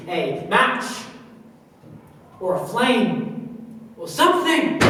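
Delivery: building to a high, loud outburst; desperate shouting. Acted. Pleading with staff for a match, desperate shouting. Patient's agitated demand for fire.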